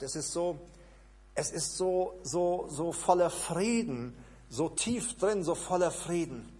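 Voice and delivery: ordinary talk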